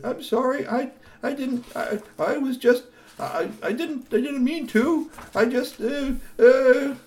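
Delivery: high stammering, nervous sorry; flustered apology. Acted. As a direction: stumble through a guilty apology.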